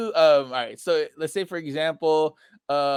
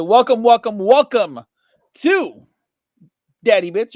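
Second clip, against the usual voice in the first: hype intro; 5 semitones higher, 8 dB louder than usual. Acted. Show intro, hype host call.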